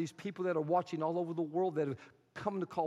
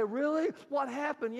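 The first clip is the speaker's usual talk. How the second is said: surprised townspeople; 10 semitones higher than usual. Acted. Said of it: "They say".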